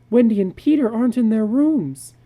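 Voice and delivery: high, loud alarm; panicked warning. Acted. Wife alarmed by screams at night.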